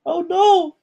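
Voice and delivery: high, loud cry of dismay; dismayed shout. Real, not acted. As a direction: yelp it out in horror. Hit by a damaging shot.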